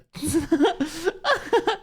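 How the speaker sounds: high-pitched cackling burst; laughing. Real, not acted. Cracks up after mock search.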